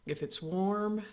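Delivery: normal talking voice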